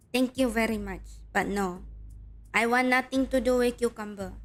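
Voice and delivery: ordinary talk, nothing marked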